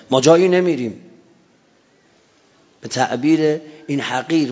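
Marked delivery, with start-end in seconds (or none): 0.1-1.0 loud cry of refusal; a battlefield cry of loyalty; shouted defiance. Retold or imitated. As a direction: call it out loud and firm. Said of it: "فریاد زد".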